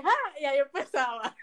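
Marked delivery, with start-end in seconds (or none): 0.0-0.4 high-pitched stage "ha"; performed "ha". Retold or imitated. Actress rising to start the chant, high "ha". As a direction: pop out a high "ha". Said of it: "me levanto dije".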